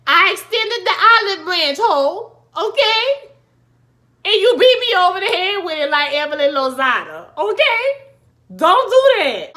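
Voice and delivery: high, loud, sassy scolding; sassy loud telling-off. Pretend. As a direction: put her in her place, loud and sassy. Voicing Issa telling off Molly.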